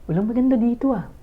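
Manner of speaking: student in class hunting for a pretty girl, lightly voiced picky thought; vain student's thought. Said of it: "sasabihin niya".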